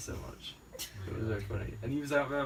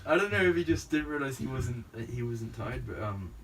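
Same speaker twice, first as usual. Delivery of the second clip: normal talking voice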